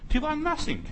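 "Die waren nothing" mocked in a high, scornful voice; scornful jab. Shown as a demonstration. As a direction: toss off "nothing" in a high sneer. Scornful repeat of a phrase.

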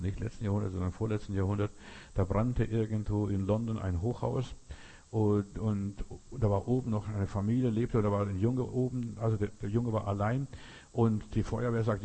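ordinary delivery

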